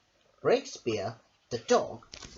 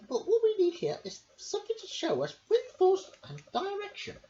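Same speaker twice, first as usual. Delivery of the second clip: much higher, squeaky little lady voice; Missus Armitage voice. Acted. Missus Armitage asks her dog a question, high and squeaky. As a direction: pipe it up like a fussy little lady. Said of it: "said Missus Armitage".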